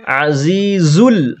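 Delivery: আজিজুল with the জি drawn out; stretched azizul. Shown as a demonstration. Teacher modelling the stretch on জি. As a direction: lengthen the জি in আজিজুল. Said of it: "জি এ টানলাম".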